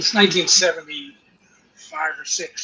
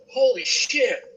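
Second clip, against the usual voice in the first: higher stunned curse; awed; 9 semitones higher, 4 dB louder, slower than usual. Retold or imitated. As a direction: let the disbelief lift it up. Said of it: "I go".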